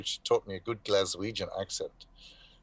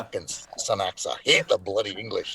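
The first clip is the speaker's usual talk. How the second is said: thick drunken-actor Scottish accent, imitated. Scottish actors cursing the English after malts, mock Scottish accent. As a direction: curse in a put-on Scottish accent. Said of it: "they get very, very Scottish".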